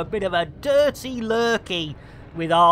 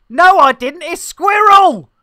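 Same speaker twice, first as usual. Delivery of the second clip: excited; 10 semitones higher, 11 dB louder than usual. Real, not acted. Excited exclaim.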